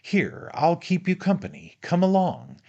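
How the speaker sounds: high, hearty invitation; urging on. Acted. Friend invites him onto the slide, urging.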